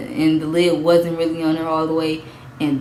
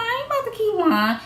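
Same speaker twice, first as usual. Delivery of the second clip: high put-on voice, a bit softer; quoted self; 4 dB quieter than usual. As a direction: squeak out the quote in a higher voice. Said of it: "I'm like".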